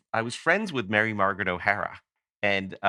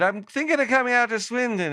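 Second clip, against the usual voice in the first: much higher, louder, eager fan voice; 13 semitones higher, 4 dB louder than usual. Retold or imitated. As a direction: pitch it up like a nervous fan inviting himself. Retelling his call to Andy.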